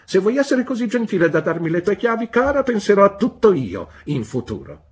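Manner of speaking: high, loud, sweetly overbearing; sweet on top, steel beneath; honeyed demand. A narrator's reading. Spinster asking the wife for her keys, high and honeyed.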